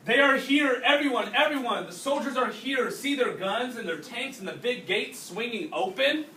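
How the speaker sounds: high, loud, thrilled cry that they've come; excited announcement. Acted. Child telling everyone the soldiers arrived, thrilled and loud. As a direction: burst out with the big news.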